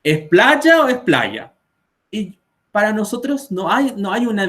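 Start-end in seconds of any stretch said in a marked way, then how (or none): 0.0-1.5 high, confused student question; puzzled students. Retold or imitated. Students ask the teacher which playa is right. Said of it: "me preguntan los alumnos".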